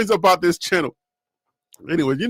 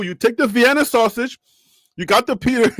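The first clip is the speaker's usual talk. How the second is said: ordinary talk, nothing marked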